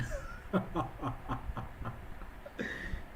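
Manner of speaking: long, low, soft laugh; real laugh. Real, not acted. Streamer tickled by own new accent, laughing low. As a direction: laugh quietly in a trailing run.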